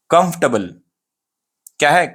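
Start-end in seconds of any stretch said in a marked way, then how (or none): 0.1-0.7 "कंफर्टेबल" shortened to KUMF-tuh-bul; right pronunciation. Shown as a demonstration. Teacher tells what it is called, "कंफर्टेबल" as kumf-tuh-bul. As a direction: drop the "er", reduced ending in "कंफर्टेबल". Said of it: "ये right pronunciation है".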